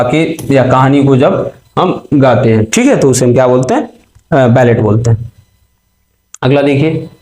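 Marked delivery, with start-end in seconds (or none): none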